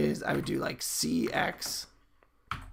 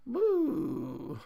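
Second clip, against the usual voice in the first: a high, happy cheer; excited whoop; 10 semitones higher than usual. Real, not acted. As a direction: whoop at the result on screen. Cheering the sprite falling fast.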